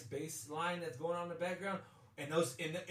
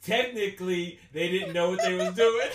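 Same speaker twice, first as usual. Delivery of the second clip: laughing; 2 semitones higher, 12 dB louder than usual. Real, not acted. Teasing in laughter.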